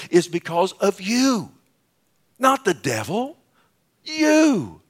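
"you" pitched very high and drawn down; high, forceful rebuke landing on "you"; pointed accusation. Acted. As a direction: hit "you" hard, climbing to the last one. Preacher confronts the praying couples.

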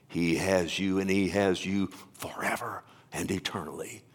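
low voice sinking into a whisper; hushed assurance. Real, not acted. Preacher assuring ministers God holds them, hushed. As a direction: drop to a reverent whisper. Hushed close after loud proclamation.

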